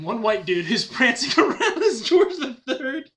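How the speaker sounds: amused, laughing delivery; real laughing. Real, not acted. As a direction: crack up while describing it. Amused at the Hamilton casting.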